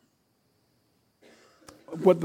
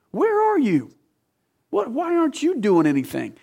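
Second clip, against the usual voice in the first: much higher, mocking where are you; sarcastic retort; 8 semitones higher than usual. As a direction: throw the question back at God sarcastically. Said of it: "I catch a little bit of sarcasm in that".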